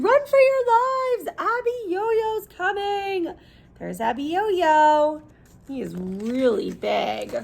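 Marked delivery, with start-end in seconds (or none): 0.0-3.3 panicked warning. Acted. Townsfolk shouting to everyone, panicked warning.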